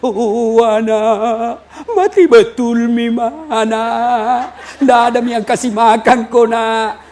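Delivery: slow, sung-out mocking wail; mock lament. Mourning mother lamenting her dead child, hammed-up slow wail. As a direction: wail it out like a sinrili chant. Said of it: "menangis sampai ... jadi sinrili".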